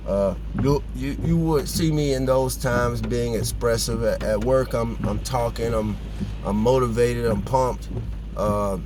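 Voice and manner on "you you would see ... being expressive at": ordinary talk, nothing marked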